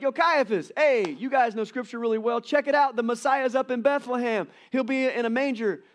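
high, loud, breezy angel announcement; casual hype angel. Retold or imitated. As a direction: hype up the high priest like a buddy. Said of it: "why didn't the angels be like".

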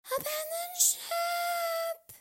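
very high, long squeal; screamed alarm. A narrator's reading. Lul warning the others as teacher wobbles, high scream. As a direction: squeal it out, dragging the last word. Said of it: "Lul screamed".

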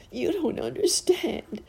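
put-on very high, tearful child's protest; acted high-pitched sobbing; sobbing harder. A narrator's reading. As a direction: wail it like a little girl in tears. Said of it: "she sobbed harder than ever".

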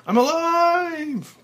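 very high, loud, slow joyful shout. Acted. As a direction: shout with sheer joy. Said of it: "finally shouting with joy".

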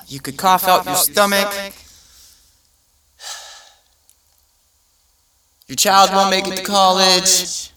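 loud, high cheery doom; cheerful ad with eerie menace. Retold or imitated. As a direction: announce disaster gleefully. Ad listing side effects.

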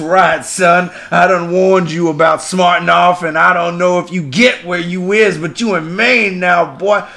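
put-on a bayou judge scolding a Yankee, thick accent; thick Cajun accent. Judge scolding the defendant, thick Cajun accent. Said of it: "the congressman and the judge are both hard accents".

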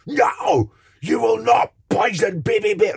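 very high, loud shriek of outrage; imitated little girl's shout. Little girl shouting at the panto villain, mimicked high-pitched yell. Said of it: "And she shouted at me".

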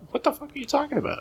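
high, fast outburst of disbelief; mock incredulity. Imagined interviewee reacting to absurd question, mock incredulous. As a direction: play a baffled interviewee, pitch shooting up.

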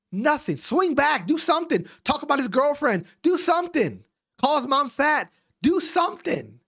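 high, insistent exasperated demands; pissed-off rant. Real, not acted. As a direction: fire off orders, goading them to fight. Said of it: "I'm pissed right now".

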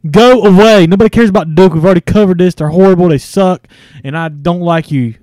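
loud angry yelling; shouting. Real, not acted. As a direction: yell at him to go away. Yelling at friend over Duke.

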